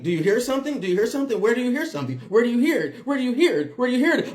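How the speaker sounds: insistent, hammed-up alarm; frantic questioning. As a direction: imitate a neighbor firing off the same question. Mimics neighbor's repeated frantic questions.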